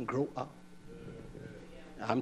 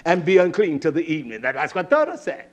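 loud, raised scripture declaiming; forceful preaching; 6 semitones higher, 14 dB louder than usual. Real, not acted. Preacher reading Leviticus on copulation to the congregation, forceful preaching. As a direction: proclaim the verse to the congregation.

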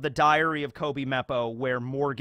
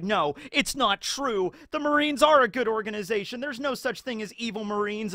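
Kobe crying; 7 semitones higher than usual. Retold or imitated. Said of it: "runs off crying".

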